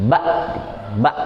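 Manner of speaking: "Ba" stopped short with a glottal stop; pressed hamzah. Shown as a demonstration. Teacher contrasting it with ba'diyah, ba slightly pressed. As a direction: cut "Ba" off cleanly, without ain. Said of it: "kayak agak ditekan sedikit".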